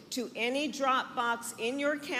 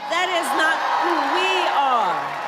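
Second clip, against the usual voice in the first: "That is not who we are" louder, "we" stressed; louder rally repeat; 4 semitones higher, 8 dB louder than usual. Real, not acted. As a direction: build it into a loud refrain.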